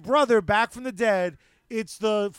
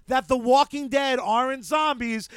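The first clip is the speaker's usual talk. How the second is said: usual speaking voice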